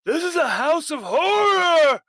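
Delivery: shrieked high and loud; high screaming horror. Acted. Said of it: "as he's just screaming".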